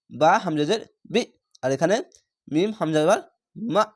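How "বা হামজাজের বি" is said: usual speaking voice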